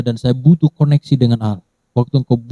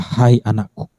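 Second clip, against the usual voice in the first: tender call; 3 semitones lower, slower than usual. Acted. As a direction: say it like a father to his child. Jesus voiced gently at close.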